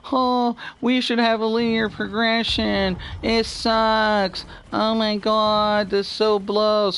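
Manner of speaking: high, drawn-out whiny complaint; high-pitched mock crybaby whine. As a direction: imitate whiners with long, dragged vowels. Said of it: "a lot of people whine and Q Q".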